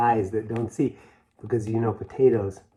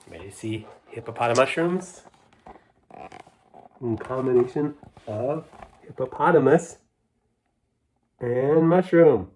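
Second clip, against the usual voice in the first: usual speaking voice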